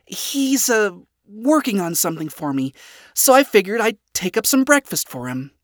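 awkward excuse. A narrator's reading. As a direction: explain it away to a disapproving father. Daughter makes excuse to tense father.

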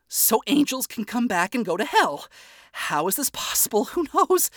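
high, unhinged, sleepless rambling ending in a laugh; delirious mumbling. A narrator's reading. Charlie rambling deliriously to herself in the lobby. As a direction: ramble like she hasn't slept in days. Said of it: "Charlie was mumbling in a delirious state".

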